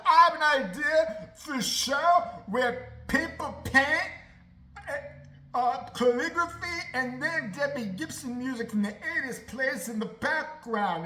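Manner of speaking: loud, slow, unhinged pitch; deranged homeless person. Retold or imitated. A deranged homeless man pitching a show, loud and slow. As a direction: rant it like a crazed drifter selling a show. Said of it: "and be like".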